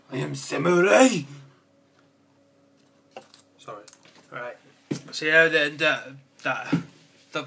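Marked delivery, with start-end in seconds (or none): 0.1-1.2 loud samurai proclamation; playful, booming samurai boast; mock samurai. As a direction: announce yourself as a samurai, much louder.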